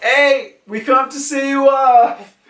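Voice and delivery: high, hearty hail to departing friends; imitated cheery holler; calling out. Yamato calls to departing crew.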